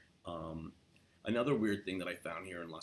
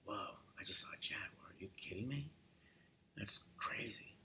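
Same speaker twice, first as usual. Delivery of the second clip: hushed, wide-eyed whisper; amazed whisper; 4 semitones higher, 6 dB quieter than usual. Pretend. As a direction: whisper in awe, pitched higher. Amazed at a jaguar sighting.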